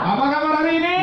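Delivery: high, loud cheer leading the crowd; hyped call-out. Real, not acted. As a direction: pump the audience up. Memimpin yel. (motivator memanggil jawaban hadirin dengan penuh semangat)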